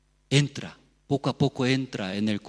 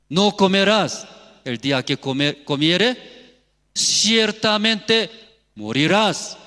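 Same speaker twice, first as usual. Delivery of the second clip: shouted warning; 7 semitones higher, 7 dB louder than usual. Acted. Preacher reminding brothers what God said, shouted warning. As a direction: hammer the warning home at full voice.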